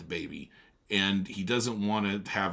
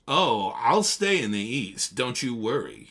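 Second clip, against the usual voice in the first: loud, higher brusque interruption; gruff Tom; 4 semitones higher, 7 dB louder, slower than usual. Acted. Tom interrupts Daisy to talk to Nick, brusque. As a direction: cut in hard.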